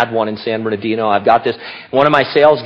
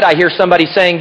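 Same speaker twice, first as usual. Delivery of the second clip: ordinary delivery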